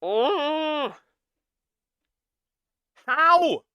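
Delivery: high, drawn-out cry of disbelief; exasperated wail. Real, not acted. Reactor baffled by the puberty take, exasperated wail. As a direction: wail it in despair.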